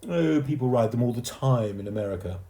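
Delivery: put-on boastful know-it-all boy; Timothy. A narrator's reading. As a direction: brag to the younger boy.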